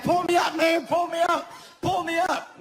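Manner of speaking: high, desperate calls for help; panicked plea. Retold or imitated. Friend dragged beside the raft, high panicked pleading. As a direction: beg to be pulled out, pitched high. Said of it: "So he was like".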